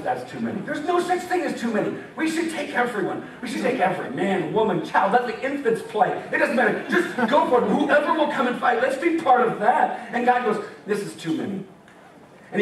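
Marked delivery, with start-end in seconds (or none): 0.0-0.6 lower, softer divine verdict; calm God voice. Retold or imitated. Preacher voicing God, calm and lower. 10.9-11.6 lower, softer divine verdict; calm God voice. Retold or imitated. Preacher voicing God, calm and lower.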